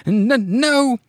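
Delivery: stammered, high, loud mock no; acted panicked cry. [name] refusing to be wrapped up, mock panic. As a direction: cry out in hammed-up alarm. [name] cries out at Professor Larvae.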